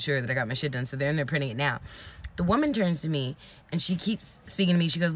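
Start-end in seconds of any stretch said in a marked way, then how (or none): none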